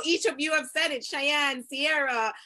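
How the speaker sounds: normal talking voice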